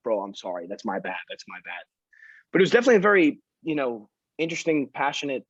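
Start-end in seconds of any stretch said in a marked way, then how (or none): 0.0-1.8 quieter and quieter, mumbled sorry; sheepish apology. Retold or imitated. Cornered by the angry fireman, a sheepish fading apology. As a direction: back down softly, fading away. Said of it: "I'm like".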